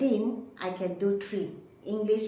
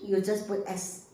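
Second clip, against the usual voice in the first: usual speaking voice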